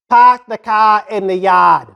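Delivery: slow, mock Boston accent with dropped R's; exaggerated non-rhotic Boston accent. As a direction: imitate a Bostonian, drawing out the open vowels. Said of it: "they got this really weird way of talking too".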